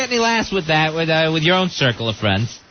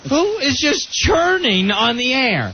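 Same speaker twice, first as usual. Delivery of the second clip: mock outraged shout; 6 semitones higher, slower than usual. Host mock-outraged at stomach noises.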